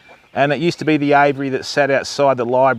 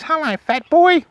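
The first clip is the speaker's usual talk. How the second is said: bird impression; 14 semitones higher than usual. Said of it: "I walked in and goes".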